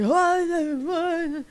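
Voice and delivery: high, startled "what?" voice; startled squeal. Acted. Game master voicing startled piggies to the players, high and surprised. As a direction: yelp it like a startled little creature.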